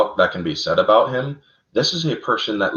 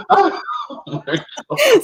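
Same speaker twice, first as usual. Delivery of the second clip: cracking up; much slower than usual. Real, not acted. Host cracking up at his cohost's laughter, a very high laughing outburst. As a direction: let it burst out laughing.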